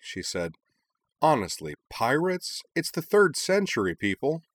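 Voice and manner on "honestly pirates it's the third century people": high, incredulous exasperation; mock outrage at pirates; exasperated. Cora complaining to friends, high exasperated disbelief.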